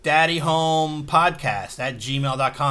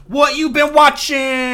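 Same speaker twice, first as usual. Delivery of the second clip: distorted, hot mic; clipping loud through the mic; fuzz distortion; 13 semitones higher, 9 dB louder, slower than usual. Real, not acted. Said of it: "Damn, that mic got hot".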